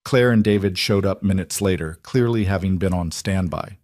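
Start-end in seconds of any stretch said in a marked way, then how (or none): none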